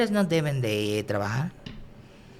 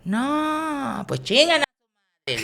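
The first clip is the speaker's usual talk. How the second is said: much higher, slow camp comeback; drag diva retort; 13 semitones higher, much slower than usual. Retold or imitated. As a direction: fire back in a shrieking diva voice. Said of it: "le digo".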